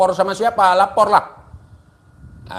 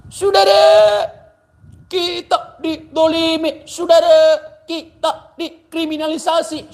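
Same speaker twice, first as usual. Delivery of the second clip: mock preacher; 9 semitones higher, 4 dB louder than usual. Mocking rival cleric's sermon.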